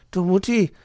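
put-on high, loud complaining child; little hedgehog complaint ending in a brrr; Borstel. A narrator's reading. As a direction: whine about it in a high voice. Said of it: "beklagte sich Borstel".

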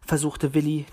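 usual speaking voice

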